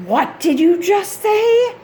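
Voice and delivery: pitched high in disbelief; shocked question. Retold or imitated. Mother stunned by daughter's word, high shocked question. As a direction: catch her saying it, high and incredulous. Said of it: "and I'm just like".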